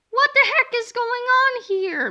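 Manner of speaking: high, loud furious shout; angry yell. Acted. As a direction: bellow it like an outraged principal. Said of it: "Mister Krupp yelled".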